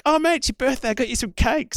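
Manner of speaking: high, cheery imitation of his mate; mock mate voice. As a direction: play the mate chirpily offering cake. Mate's birthday greeting, retold.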